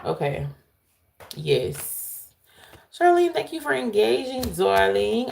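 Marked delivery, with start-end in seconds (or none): 2.9-5.3 high, bright gush to a viewer; excited thanks to a fan; gushing shout-out. Real, not acted. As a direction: sing her name out with delight.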